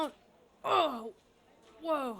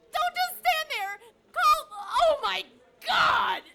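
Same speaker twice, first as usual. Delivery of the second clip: frantic pleading; 5 dB louder than usual. Acted. Frantic labour.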